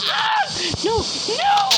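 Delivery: high scream into pleading; scream, then beg in panic; high panicked cry. Acted. Carl dying in the game, high panicked cries.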